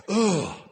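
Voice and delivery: loud, high groan of disgust; disgusted ugh. Retold or imitated. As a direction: recoil at something gross. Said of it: "you just kinda go".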